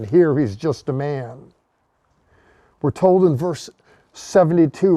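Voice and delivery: usual speaking voice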